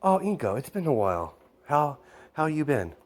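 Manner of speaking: mildly gushing, pitch jumping high; a touch of happy surprise at an old friend; delighted greeting. Acted. Friend greets Inko after a long while, mildly delighted.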